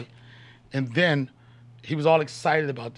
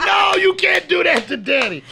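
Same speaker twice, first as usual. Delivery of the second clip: mock stern dad; 13 semitones higher, 8 dB louder than usual. Real, not acted. Mock scolding son at soundboard.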